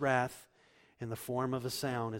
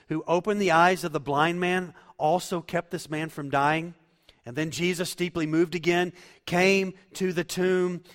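loud, high impassioned preaching; fiery sermon delivery; preaching at full force; 6 semitones higher, 13 dB louder than usual. Acted. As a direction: preach it out full-voiced to the congregation.

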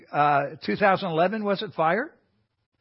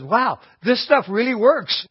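higher, louder, slow amazed wow; 7 semitones higher, 6 dB louder than usual. Retold or imitated. Recalling his own delight at applied truth, amazed and loud. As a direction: marvel at it out loud. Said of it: "I've stopped there and said".

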